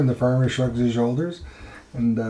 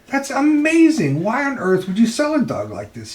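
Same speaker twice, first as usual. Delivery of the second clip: amazed guy; 11 semitones higher, 4 dB louder than usual. Retold or imitated. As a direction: sound like you can't believe it. Guy amazed at the talking dog.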